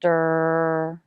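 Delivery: "Der" held long on a steady low pitch; isolated R sound. Shown as a demonstration. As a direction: sustain the R in "Der", tongue pulled back. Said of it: "See that the lips flare a bit".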